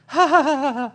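high, loud mocking ha-ha laugh of the old preacher; imitating his laugh. Pastor imitating Brother Norval's laugh, high loud ha-ha. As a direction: bark out his ha ha ha, pitched high. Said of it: "It's kinda how he laughs".

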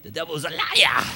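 loud, hammed-up Pentecostal preacher accent. Shown as a demonstration. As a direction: punch out LIAR like a Pentecostal preacher. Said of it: "say it with a little Pentecostal accent".

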